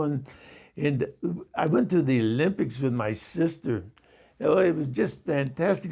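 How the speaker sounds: imitated East Indian cab driver; chatty, enthusiastic put-on East Indian accent. Cabbie tells his passenger about the Olympics, in an East Indian accent. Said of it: "I'm gonna attempt to do his accent".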